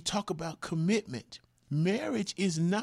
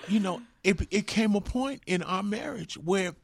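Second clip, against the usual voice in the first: ordinary talk, nothing marked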